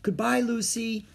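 high-pitched goodbye; farewell call. A narrator's reading. Annie calls farewell to departing friends.

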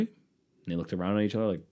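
usual speaking voice

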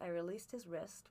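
ordinary delivery

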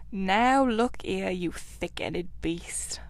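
very slow, seething reproach; angry, heavily drawn-out warning; really angry. A narrator's reading. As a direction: scold with slow, sinking menace. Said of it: "replied the rat really angrily".

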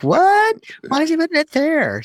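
high squeaky baffled voice; baffled surprise. Retold or imitated. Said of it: "It's just like".

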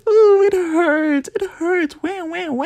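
very high put-on crybaby wail; hammed-up whimpering in a tiny high voice; mock whining. Acts out pain in the moment.

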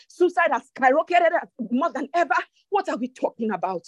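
ordinary talk

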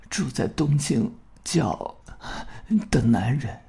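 slow, soft, hoarse and failing. Acted. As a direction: rasp the words barely out. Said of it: "他的声音太嘶哑了". (嘶哑微弱地说)

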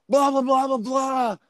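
high-pitched blah-blah rant; mock yelling. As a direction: imitate someone ranting without the words. Mimicking an argument in the car.

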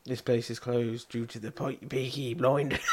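badly faked Birmingham accent, breaking into laughter. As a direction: attempt a Brummie accent and crack up. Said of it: "do a Bourbon accent".

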